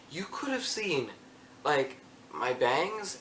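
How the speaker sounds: ordinary talk, nothing marked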